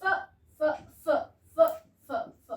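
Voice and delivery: फ puffed out alone, short and repeated; phonics sound demo. Shown as a demonstration. Teacher to children drilling the फ sound, short and repeated. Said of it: "कौन सा sound?".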